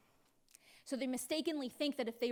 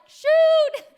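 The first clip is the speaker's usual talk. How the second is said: alarmed shout; 11 semitones higher, 16 dB louder than usual. Retold or imitated. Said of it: "they're like".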